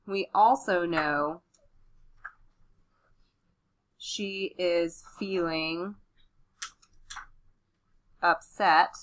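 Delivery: slow dictation pace; dictating. Shown as a demonstration. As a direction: say each word as you write it. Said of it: "So that's my next idea".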